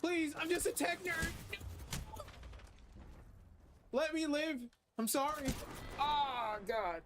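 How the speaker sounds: panicked pleading. Real, not acted. Begging enemies in the game.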